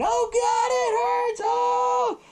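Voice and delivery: very high-pitched hammed-up agony; mock screaming. Ainsley screaming as he's torn open, mock agonized screaming. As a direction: imitate a victim screaming in pain, voice way up. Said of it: "Ainsley's screaming".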